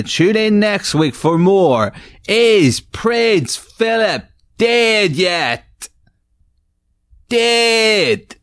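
high, slow drawn-out title call; game show sign-off. Acted. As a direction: sing out the title to close.

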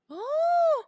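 loud high gasp of outrage; shocked gasp. Real, not acted. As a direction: gasp in disbelief. Reacts to son's audacity.

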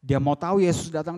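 ordinary talk, nothing marked

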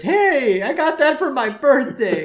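put-on high, drawn-out kid-style whine; Todd's protest. Co-host voicing a boy over his birthday gift, high protest. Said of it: "And Todd says".

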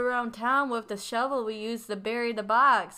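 loud trashy Southern caricature; mock Southern accent, hammed up. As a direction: brag like a dim Southern lowlife. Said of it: "I was doing a southern accent with that".

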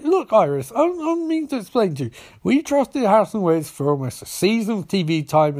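high-pitched acted exasperation; defensive. Barry argues back at Iris.

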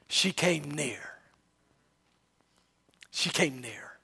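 slow, low, near-whispered narration; very soft and low, letting it hang; hushed. Real, not acted. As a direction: drop right down as she draws close. Preacher hushes as the woman approaches.

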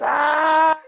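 imitated matriarch's wordless scream. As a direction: let out a raw furious shriek with no words. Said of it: "not even words".

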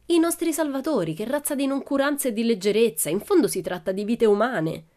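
loud, high-starting indignation; indignant. A narrator's reading. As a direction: complain in outrage about someone's negligence. Pippi impatiently scolds absent rescuers.

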